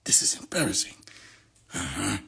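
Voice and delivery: embarrassed hushed whisper; whispered aside. Real, not acted. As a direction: whisper it under your breath.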